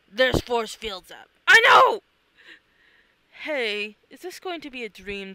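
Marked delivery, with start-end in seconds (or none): 1.5-2.0 much higher, loud yell; exasperated shout. Acted. Ally yelling back mid-fight, exasperated shout. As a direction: snap back in frustration.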